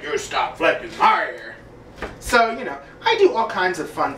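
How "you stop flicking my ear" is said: Strong Sad voice, lower take. Shown as a demonstration. Vlogger correcting his Strong Sad impression, low and mopey. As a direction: drop it low and mope. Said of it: "More like".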